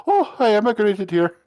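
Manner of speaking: high, loud mock Irish lilt; Irish accent. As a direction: put on a jokey Irish accent. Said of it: "I can't quite do an Irish accent".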